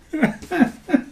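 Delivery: squeaky high laugh; high-pitched giggle. Real, not acted. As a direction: let a high giggle slip out. Laughing at his own voice.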